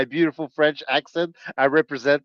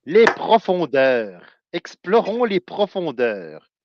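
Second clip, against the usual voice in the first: French; 4 semitones higher, slower than usual. Shown as a demonstration.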